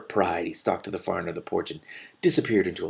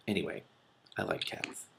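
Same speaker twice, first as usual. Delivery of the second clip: soft reply; 3 semitones lower, 12 dB quieter than usual. A narrator's reading. Morwen's quiet answer.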